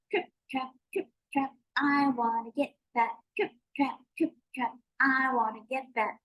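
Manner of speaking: tiny high-pitched trip-trap chant; small, squeaky-high goat on the bridge; littlest billy goat. Acted. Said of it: "he made a sound like this".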